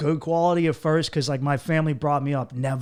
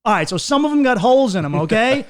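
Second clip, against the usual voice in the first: defensive mock outburst; 8 semitones higher, 7 dB louder than usual. Defending himself to the girl.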